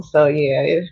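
ordinary delivery

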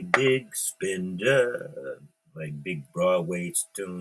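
ordinary talk, nothing marked

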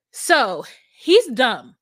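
slow and loud, leaning on dumb; emphatic verdict. Real, not acted. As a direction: drop the verdict hard and slow. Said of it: "emphatic verdict".

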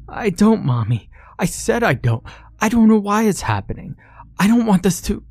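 put-on a boy insisting he is innocent; desperate little boy. A narrator's reading. Johnny denies it to Mommy, high desperate child.

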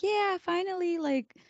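high, drawn-out mock celebration; put-on gleeful relief; imitated cheering. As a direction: voice a kid thrilled school is off. Daughter cheering school closure.

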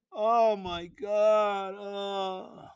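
slow, drawn-out despairing oh my God; weary groan. Real, not acted. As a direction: sigh it out defeated. Groaning despair after story.

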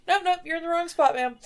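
brisk matter-of-fact. Retold or imitated.

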